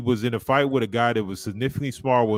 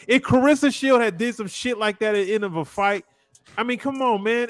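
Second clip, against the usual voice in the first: heated rant; 9 semitones higher, 4 dB louder than usual. Real, not acted. Ranting about Joshua's antics.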